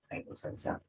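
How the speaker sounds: Bailó salsa very soft, rising on salsa; very quiet question, salsa lifted at the end; Bailó salsa with a hushed ascending tune. Shown as a demonstration. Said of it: "Tono ascendente".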